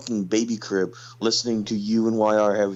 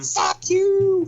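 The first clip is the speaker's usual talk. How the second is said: mock shout; 5 dB louder than usual. Answers how to swear in Italian.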